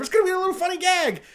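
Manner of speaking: high, quick, breezy sell; mock upbeat pitch. Studio boss selling a gag to the writer, mock upbeat.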